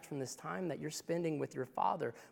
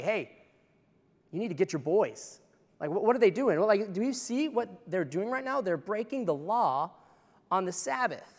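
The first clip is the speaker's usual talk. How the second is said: loud, outraged complaint, pitch higher; indignant Pharisees; 5 semitones higher, 5 dB louder than usual. Retold or imitated. Pharisees complaining to Jesus about his disciples, loud and outraged. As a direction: tattle on the disciples with mock outrage, slowing at the end. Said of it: "and they're like".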